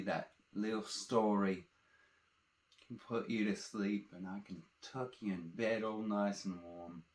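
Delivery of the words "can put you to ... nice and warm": ordinary delivery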